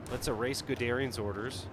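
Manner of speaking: normal talking voice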